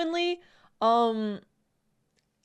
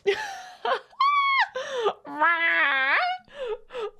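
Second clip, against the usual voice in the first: wailing cackle; high shrieking laugh; 11 semitones higher than usual. Real, not acted. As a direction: howl with laughter. Streamer cracks up at chat.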